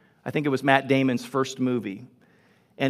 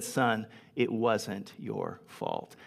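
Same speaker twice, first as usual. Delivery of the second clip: much softer and very slow, lightly voiced mentor; tender, leaning in; 5 semitones lower, 9 dB quieter, much slower than usual. Mentor leaning in to the broken young man, slow and soft. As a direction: lean in and lay each word down gently. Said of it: "Williams leaned in again, and he said".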